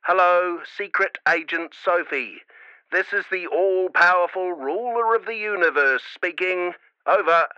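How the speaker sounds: mum over a crackly walkie-talkie; playful voice through radio static; scratchy radio voice. A narrator's reading. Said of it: "heard a scratchy voice".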